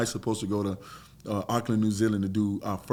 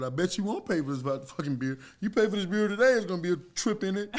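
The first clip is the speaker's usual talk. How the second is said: mild mock threat; 8 semitones higher than usual. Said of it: "And now I'm getting mad".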